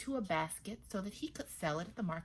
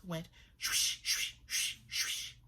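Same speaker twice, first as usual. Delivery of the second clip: breathy swish noises; swish sound; slower than usual. A narrator's reading. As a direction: make the cloth rustle. Cloth rustling sound.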